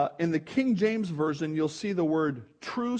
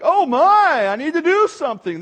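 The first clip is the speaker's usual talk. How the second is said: loud, high burst of urgency; hammed-up urgent exclamation; acted alarm; 9 semitones higher, 11 dB louder than usual. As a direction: cry out as if rushing to help. Acted alarm at seeing someone bleeding.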